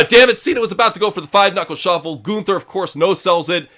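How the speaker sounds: fast, loud ringside call; play-by-play commentary. Acted. Streamer calling the match for chat, fast play-by-play.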